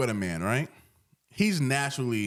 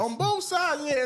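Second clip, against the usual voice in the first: much higher, playful squeal; high squeaky echo. Real, not acted. Echoing the cohost in a high squeal.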